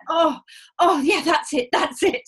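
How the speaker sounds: mock moaning. Patient teasing the doctor, hammed-up loud moaning. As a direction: tease him with fake delight. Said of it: "be like".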